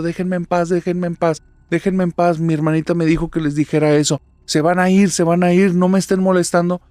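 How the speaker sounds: loud, high, terrified pleading; crying and pleading. Acted. Little sister screams at the dolls in her room, high and terrified. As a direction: beg them to leave you alone through tears. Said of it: "llore y llore diciendo".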